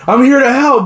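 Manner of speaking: high, pushed-up imitated plea; mock pleading protest. Would-be helper to a cop, mock pleading. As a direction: plead insistently in a comic high voice.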